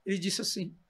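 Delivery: ordinary talk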